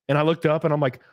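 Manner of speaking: usual speaking voice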